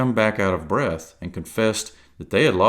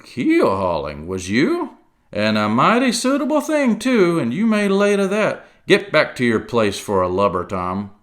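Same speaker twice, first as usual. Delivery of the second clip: loud, scornful sea cook; gruff pirate voice; 5 semitones higher, 5 dB louder than usual. Acted. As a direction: scoff like an old pirate. Silver role.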